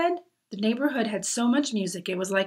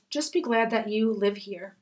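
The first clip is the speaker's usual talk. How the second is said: usual speaking voice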